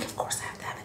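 hushed whisper aside. Real, not acted. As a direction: whisper it like a secret.

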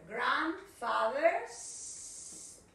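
Grandfather's stretched very slowly, then house; careful slow modelling; slow model. Shown as a demonstration. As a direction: draw out Grandfather's for the class. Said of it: "a la casa de tu grandfather".

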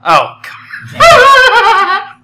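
high, loud burst of real laughter; laughing. Real, not acted. Co-player reacting to the joke, real loud laughter. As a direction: crack up loudly through the words. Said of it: "okay, that laugh".

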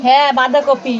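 ordinary talk, nothing marked

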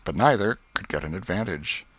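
ordinary delivery, nothing marked

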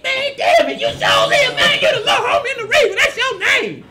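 very high, loud squealing imitation; excited mailman. Mailman discovering the perfect name, hammed-up excited shout. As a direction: burst out fast and high with laughter in it. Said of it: "He said".

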